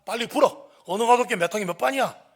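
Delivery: loud, high interrogating yell; hard, shouted demand for a confession. Retold or imitated. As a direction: bark it like a police detective. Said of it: "제가 더 세게".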